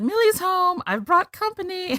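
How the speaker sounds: high, singsong arrival call; Millie announcing herself. Retold or imitated. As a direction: call out high that you're home with guests. Acting Millie's arrival in retelling.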